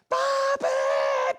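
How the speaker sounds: very high, drawn-out squealed call; child's yell. Retold or imitated. Son calling from across the house, screaming happily. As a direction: yell for Dad like an excited little boy. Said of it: "pero gritando".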